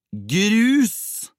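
loud, high yell on one long word; outraged shriek. A narrator's reading. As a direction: cry out GRUS in horrified disbelief. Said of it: "hadde P skreket". (opprørt, høyt skrik)

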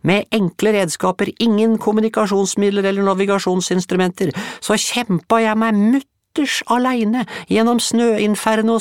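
very high, loud heroic boast; boastful tiny boy. Acted. Bulle boasting to the women of his brave expedition. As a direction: puff up like a tiny polar hero.

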